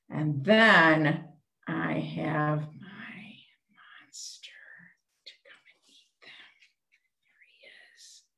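hushed secret reveal; whisper. Acted. Teacher whispering to the children as the monster appears. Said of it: "whispered monster reveal".

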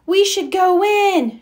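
high, loud urgent call; shouted. Acted. Franklin urging friends inside as rain falls, shouting. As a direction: shout it over the wind. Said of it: "shouted Franklin".

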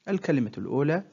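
ordinary delivery

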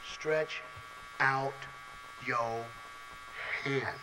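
Jesus' command spoken slow and low; slow hushed command. Retold or imitated. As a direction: let every word hang in the silence. Same command retold, slow, hushed.

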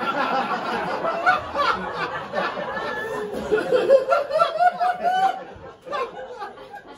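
long falling cackle up high; real high-pitched laughter. Real, not acted. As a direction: crack up at own punchline. Long laugh after punchline.

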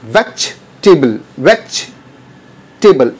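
वेजिटेबल said slowly with the middle e dropped, like vej-table; correct pronunciation, slow. Shown as a demonstration. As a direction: stretch out वेजिटेबल with a silent e. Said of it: "ई का sound बिल्कुल silent". (वेजिटेबल धीरे से, बीच का ई silent)

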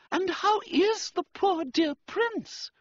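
very high, drawn-out female voice; slow, high-pitched society sympathy; gushing society lady. A narrator's reading. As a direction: coo with concern in a high voice. Society lady asks after prince.

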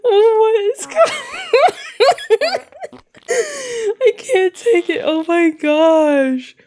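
high squeal breaking into laughter; helpless laughter. Real, not acted. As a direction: laugh so hard the words stretch out. Helpless laughter at toilet scene.

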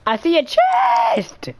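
high, slow squeal of delight; a slow squealing cry of joy; excited. Real, not acted. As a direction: sing it out high and thrilled. Excited spotting loot.

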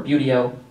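buteo said "BYOO-dee-oh"; variant pronunciation. Shown as a demonstration. Lecturer offering audience pronunciation options, variant pronunciation of buteo as "BYOO-dee-oh". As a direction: say buteo starting like "beauty". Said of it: "You say tomato".

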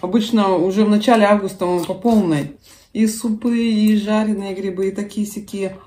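speaking between spoonfuls; slightly full mouth; talking while eating soup. Real, not acted. Seen 3: Holds soup spoon while talking; lips pursed at times; spoon to mouth at 13.0.

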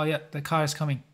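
ordinary talk, nothing marked

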